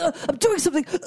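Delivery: imitated flustered refusal. Boyfriend putting off his girlfriend, flustered. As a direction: imitate someone too busy to come. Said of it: "acting like he's so unable to do it".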